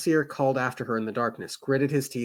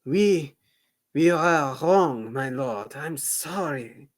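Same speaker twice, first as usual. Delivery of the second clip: put-on French accent; 3 semitones higher, 4 dB louder, much slower than usual. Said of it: "my French accent".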